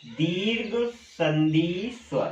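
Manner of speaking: slow, drawn out while writing it down; slow dictation. Real, not acted. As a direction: say it slowly as you write. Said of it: "तो मैं यहाँ लिख रहा हूं". (लिखते हुए धीरे-धीरे बोलें)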